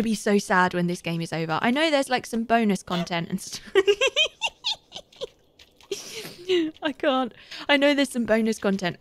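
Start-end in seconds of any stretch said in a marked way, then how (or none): 3.8-5.2 high-pitched squeaky giggling fit; helpless giggle. Real, not acted. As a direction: crack up in a long, high giggle. Cracks up at own words.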